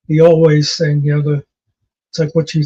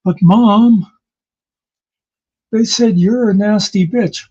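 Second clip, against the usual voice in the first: put-on protesting kid; 5 semitones higher, 3 dB louder than usual. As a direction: play a kid tattling in a raised voice. Imagined child protesting to mother.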